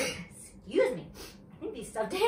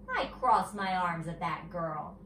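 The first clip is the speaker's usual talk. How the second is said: ordinary delivery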